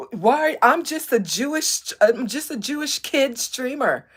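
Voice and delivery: loud, high mock plea; imitated young streamer. Streamer mocking a young man pleading he's just a kid, whiny. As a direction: mimic a put-upon kid protesting. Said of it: "the most passive-aggressive".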